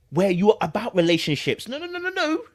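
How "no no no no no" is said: ordinary delivery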